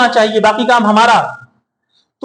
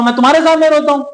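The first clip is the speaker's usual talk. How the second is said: heated outburst; 7 semitones higher, faster than usual. Acted. Voicing Yaqub's grief, heated.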